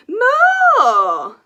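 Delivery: high, loud commanding cry; teacher's shout. Acted. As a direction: bellow it at the class. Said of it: "said Madam Dragon".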